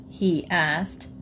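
ordinary delivery, nothing marked

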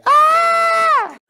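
a sudden high yelp of feeling; high emotional cry. Real, not acted. Streamer reacting to the film ending, high emotional cry.

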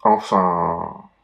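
low, dry afterthought; wry aside. A narrator's reading. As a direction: drop it as a wry twist. Ironic twist ending.

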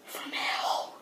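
breathy whispered tagline; whispering. Acted. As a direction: whisper it like a sinister secret. Devil intro, whispered tagline.

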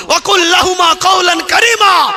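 high, loud proclaimed verse; preacher's shout. Acted. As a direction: ring it out to the crowd. Preacher quotes verse forcefully.

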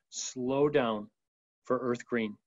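gently slow it down; hushed, unhurried reassurance; calm and slow. Shown as a demonstration. Demonstrates slowing down for green.

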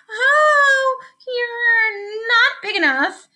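high, wailing, drawn-out excuse; alarmed backing down. A narrator's reading. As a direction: wail slowly as you back out. Grouchy ladybug backing down from skunk.

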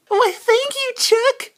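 very high falsetto bird voice; bird character. Pretend. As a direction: squeak it out high, happily healed.

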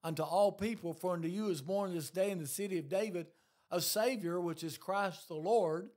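loud, rising pulpit preaching; preaching at full force. Acted. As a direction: drive the scripture home to the congregation.

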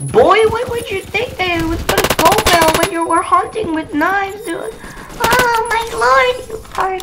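high squeaky voice, loud and cocky; squeaky taunting gamer. Acted. Gamer taunting an opponent in a squeaky cartoon voice. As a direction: taunt the enemy in a silly high voice.

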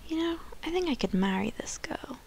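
put-on hushed, breathless whisper as the smitten boyfriend; whispered Ernie voice. Player voicing her Sim boyfriend, whispering. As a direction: whisper it like a secret crush. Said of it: "He's like".

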